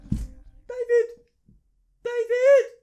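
full-volume squeaky shout imitating the friend; high-pitched yell. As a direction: bellow David up high so he turns round. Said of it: "my friend's like".

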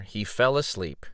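usual speaking voice

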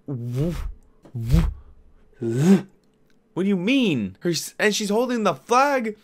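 can't believe what you're seeing; incredulous outrage. Real, not acted. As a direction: voice climbing in shock. Outraged disbelief at untouched cell.

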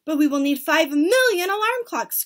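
high, loud objection; cried out. A narrator's reading. Audience objecting to the plan, cried out. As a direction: protest it aloud. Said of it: "cried the audience".

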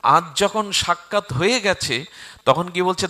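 ordinary talk

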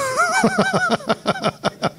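real falsetto giggle; high squealing laugh. Real, not acted. Comedian cracking up over the crazy woman, high laugh. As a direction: let out a long high laugh.

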